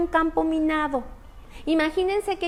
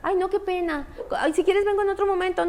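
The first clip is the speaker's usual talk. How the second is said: embarrassed visitor; 6 semitones higher than usual. Retold or imitated.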